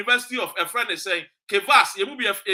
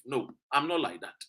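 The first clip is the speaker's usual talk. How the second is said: normal talking voice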